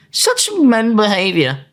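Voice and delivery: high, slow, drawn-out mockery; mocking disbelief. Real, not acted. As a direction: stretch it out in disbelief. Mocking male behaviour.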